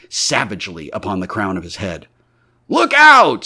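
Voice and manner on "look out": very high, loud alarm call; crowd's warning shout. A narrator's reading. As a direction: yell a warning to everybody. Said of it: "said everybody".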